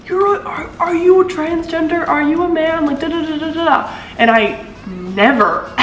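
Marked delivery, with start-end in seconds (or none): 0.1-2.8 high, mock-incredulous commenter voice; lightly ape a nosy commenter. Mimicking a commenter asking if she's a man. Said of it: "the comment section was like". 2.8-4.0 high dismissive da da da; mocking. Waving off the comments.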